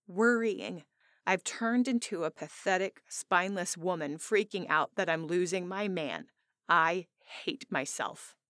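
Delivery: usual speaking voice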